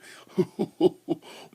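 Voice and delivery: big loud cackle; loud laugh. Real, not acted. Mocking nascar drivers, loud laugh. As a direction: burst out laughing. Laugh before redneck impression.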